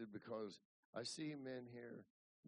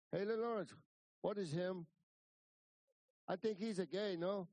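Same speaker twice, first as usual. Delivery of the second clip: loud, higher-pitched character voice; imitating Alvino; 7 semitones higher, 7 dB louder than usual. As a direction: play a blunt ranch hand speaking broken English. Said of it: "Alvino looks at me and says".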